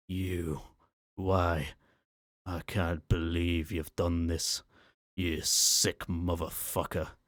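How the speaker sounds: a lightly voiced angry Scotsman; a slow, disgusted Scottish brogue; male Scottish accent. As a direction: growl it out in a Scottish accent, slowing on the last words. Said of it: "now speaking in a male Scottish accent".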